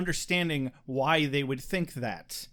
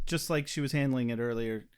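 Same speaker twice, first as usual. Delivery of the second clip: normal talking voice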